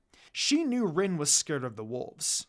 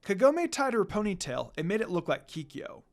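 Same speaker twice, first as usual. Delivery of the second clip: ordinary delivery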